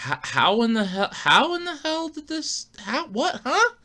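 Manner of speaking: high, stammering disbelief rising to a squeak; can't believe it's that easy; incredulous. Retold or imitated. Owner hears the fix is easy, high disbelief.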